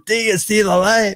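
lightly voiced movie preacher. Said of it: "[name] is the preacher in the church".

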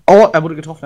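loud excited callout; man down shout. Real, not acted. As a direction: shout it out to the team. Enemy hit during fight. (lauter Ausruf)

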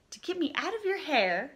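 usual speaking voice

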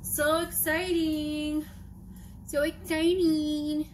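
slow, mock-excited drawl; sarcastic excitement. Vlogger rinsing a pan, slow sarcastic excitement. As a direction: sarcastically pretend this is thrilling. Bored rinsing, fake enthusiasm.

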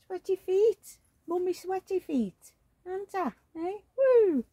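put-on high cooing voice for a baby; sing-song baby talk. Mum cooing at her baby doll, high baby talk. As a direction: coo up high to the little one.

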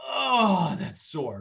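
sharp high "oh" of pain, then dropping; pained wince. Acted. Coach acting out a sore stretch, pained wince.

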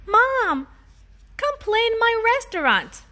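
put-on high child's call; little boy voice. Daniel calling his mom to play, high childlike voice. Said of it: "Daniel said".

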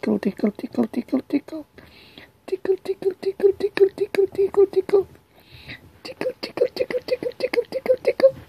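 giggly tickle attack. Acted. Tickling the pet.

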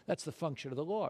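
normal talking voice

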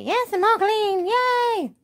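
high, slow cheery dog voice; the owner putting words in the dog's mouth, squeaky and happy; voicing the dog. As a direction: speak for the dog, thrilled.

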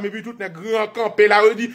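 usual speaking voice

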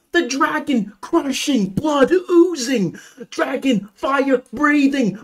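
high, gushing list of wonders; rapturous gushing. Real, not acted. Fan raving about Damsel's scenes, high and thrilled. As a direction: rattle off every scene in rapture.

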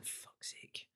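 whispered under the breath, fed up; muttered frustration. Retold or imitated. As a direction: mutter it to yourself in disgust. Player frustrated at coach.

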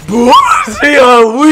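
loud high gleeful goodbye; gleeful taunt. Real, not acted. Taunting his friend after blowing him up, loud high glee. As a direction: taunt them as they blow up.

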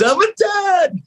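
high, giddy burst of joy; elated. Retold or imitated. New father's inner half cheering, elated and high. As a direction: blurt it out like a thrilled new father.

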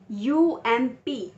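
यू एम पी spelled letter by letter, very slowly; slow spelling out. Shown as a demonstration. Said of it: "इस तरीके से sound पढ़ाते हैं". (यू एम पी धीरे-धीरे अक्षर बोलना)

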